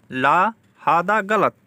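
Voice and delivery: "ला हादा गलत" slowly, each word set apart; slow repeat. Shown as a demonstration. Teacher repeats the Arabic slowly for learners. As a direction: drag it out so it sticks. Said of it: "slow repeat".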